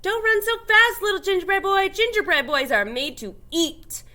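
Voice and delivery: high, loud call after him; threshers calling. A narrator's reading.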